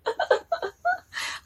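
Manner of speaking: very high-pitched giggle; amused laugh. Real, not acted. Finding the flustered angels very funny, giggling. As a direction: break into helpless giggles mid-story. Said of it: "我心里就觉得很好笑".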